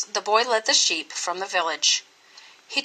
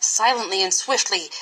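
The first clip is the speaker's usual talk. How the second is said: ordinary delivery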